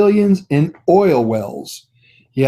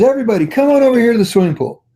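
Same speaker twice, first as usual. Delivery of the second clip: higher, faster call to the whole crowd; a mock host hollering everyone over; 6 semitones higher, faster than usual. Old man calls party guests to the pool, mock hollering.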